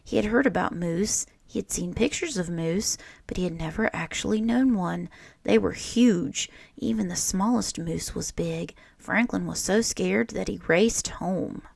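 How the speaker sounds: put-on lively read-aloud voice for children; storybook reading. A narrator's reading. Teacher reading a picture book to children, lively storytelling. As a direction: read it like story time for a class.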